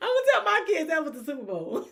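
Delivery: laughing through the line, high and loud. Real, not acted. Vlogger recalling the winning kick, laughing hard.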